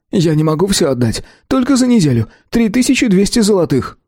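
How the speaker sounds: timid shopkeeper. A narrator's reading.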